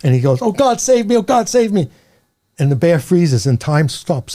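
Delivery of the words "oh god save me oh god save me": high, urgent cry for help; mock-terrified plea, pitched way up; panicked plea. Atheist begging God as the bear leaps, panicked plea.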